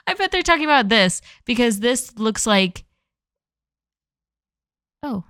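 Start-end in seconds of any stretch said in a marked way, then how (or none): 0.1-1.2 higher, louder, faster excited guess. Real, not acted. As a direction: blurt it out, thrilled.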